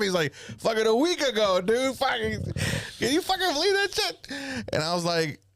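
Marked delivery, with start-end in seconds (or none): 0.3-4.3 retold high-pitched outrage; incredulous dad. Dad telling his son a shocking story, high incredulous exclaiming. Voices dad's outraged account.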